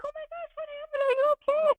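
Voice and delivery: high, worried mock chorus; worried onlookers. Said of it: "everybody's like".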